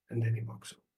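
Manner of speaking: ordinary talk, nothing marked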